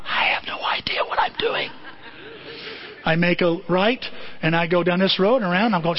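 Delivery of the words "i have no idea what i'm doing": sheepish whisper admitting he's lost; whispered confession. Retold or imitated. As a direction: whisper it as a guilty aside. Secret aside to the audience.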